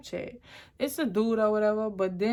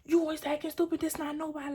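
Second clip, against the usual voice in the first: raised voice imitating a panicked boyfriend; a hint of his freak-out, voiced higher; 8 semitones higher than usual. Imitating her boyfriend freaking out at her in the car. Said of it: "he started to freak out".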